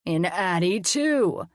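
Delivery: very slow, drawn-out silly monster voice; funny voice. Acted. Colin playing mad scientist to the kids in a funny voice. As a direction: stretch it out like a cartoon mad scientist.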